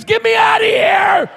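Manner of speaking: very high, loud, drawn-out scream; imitated desperate scream. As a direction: scream as if trapped in a casket. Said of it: "all we could hear was".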